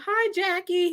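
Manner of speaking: high-pitched singsong hello, imitated; fan greeting. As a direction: call a star in a high fan voice. Fan calling out, retold.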